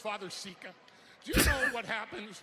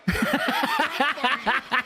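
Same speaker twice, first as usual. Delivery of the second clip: loud laughing repeat; laughing through it; 13 dB louder, much slower than usual. Real, not acted. [name] laughing at his own firing joke. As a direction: laugh it out, much louder.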